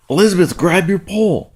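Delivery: high, quick excited command; excited dad's retold alert; urgent call. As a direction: call out urgently to your daughter. Father calls out to daughter.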